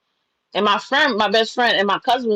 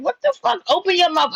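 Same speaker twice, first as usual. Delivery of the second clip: annoyed exclamation; 6 semitones higher than usual. Retold or imitated. Annoyed at mumbling guy.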